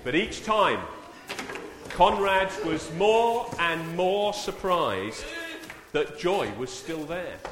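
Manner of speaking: reading the story aloud to the congregation; storyteller voice, loud and slow in places; story narration. A narrator's reading. Preacher tells the children's story to the church, storyteller voice.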